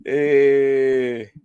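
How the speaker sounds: normal talking voice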